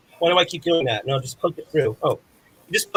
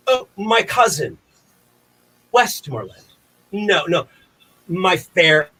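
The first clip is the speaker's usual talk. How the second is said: higher, ringing stage address; Shakespeare recitation; 4 semitones higher than usual. Acted. King Henry addresses his cousin, formal stage declamation. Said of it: "so here we go".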